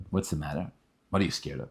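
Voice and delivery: a hint of a soft-spoken Rebbe; gentle Rebbe. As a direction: ask like a calm old rabbi.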